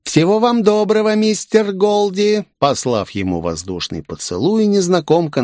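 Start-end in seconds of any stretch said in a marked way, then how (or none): 0.0-2.4 mocking farewell.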